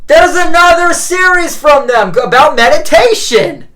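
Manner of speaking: high, loud eager call; calling out to Mom excitedly. Retold or imitated. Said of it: "I was like".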